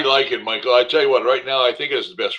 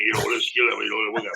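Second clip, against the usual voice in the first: softer, half-intelligible imitation of a teammate's mumbling; mumbled Motown talk; 2 semitones lower, 5 dB quieter than usual. Analyst mimicking a lineman nobody understands in the huddle, mumbled Motown talk. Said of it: "he'd be talking that Motown thing".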